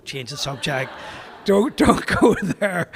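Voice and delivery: drawn-out cautioning voice; warning wife. Acted. Imagined wife warning him in his head.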